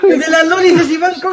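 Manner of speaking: playful laughing yell; laughing mock shout. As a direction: yell the accusation through laughter. Shouted joke accusation.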